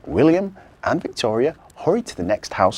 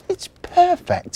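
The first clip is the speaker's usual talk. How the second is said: very high, slow delight; thrilled elf; 8 dB louder, slower than usual. Acted. Marzi loves her present, high thrilled. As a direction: savour the gift.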